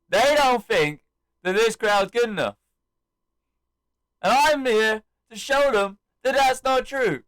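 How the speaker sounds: high, loud arena speech; rally the crowd in punchy phrases; re-enacted wrestler on the mic; imitated rousing promo. Acts out Cena's rousing promo.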